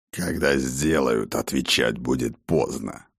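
low, grave warrior voice; stern old warlord. Acted. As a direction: speak as a grim old war chief. Voicing the war father.

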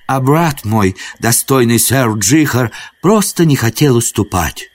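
ceremonious high-pitched prince begging pardon; courtly prince, solemnly contrite. Acted. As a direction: plead in lofty, measured chivalric speech. Prince's solemn apology to wood spirit.

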